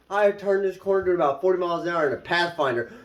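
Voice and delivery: ordinary delivery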